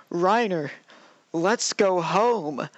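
slow, drawn-out mock pleading; mock freak-out. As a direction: imitate a freak-out, stretching the words out. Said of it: "like, starts freaking out".

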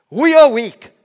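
loud, high sarcastic outcry. Acted. Paul contrasting himself with his readers, loudly sarcastic. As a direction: proclaim "weak" in a big mocking shout. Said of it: "can be quite sarcastic with the people".

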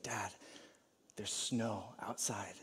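put-on slow, hushed child whisper; excited whisper. Four-year-old at the bedside tells sleeping dad, excited whisper. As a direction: hold in the excitement and keep it hushed. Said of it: "he just, like, whispers".

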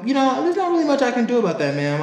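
high, fast, sugary fake-polite voice; mock polite employee voice; customer service voice. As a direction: put on the sweet work voice. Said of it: "I go".

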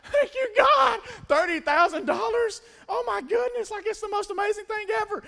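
high, loud, hammed-up tearful gratitude; mock happy crying. Joy thanking God for the job, hammed-up happy crying. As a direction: squeal thanks as if overcome with joy. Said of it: "starts crying tears of happiness".

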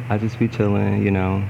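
ordinary talk, nothing marked

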